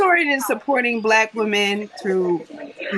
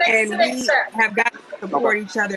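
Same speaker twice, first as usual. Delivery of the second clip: usual speaking voice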